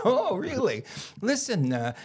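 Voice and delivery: high sarcastic mocking voice; mock him like a snotty girl at rehab. Girl at rehab mocks the new arrival, high and sarcastic. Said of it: "They're fucking laughing at me".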